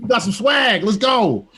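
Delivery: hype man. Real, not acted. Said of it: "hype man you up".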